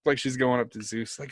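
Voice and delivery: normal talking voice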